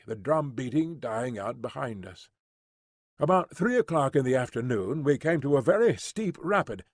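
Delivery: plain reading voice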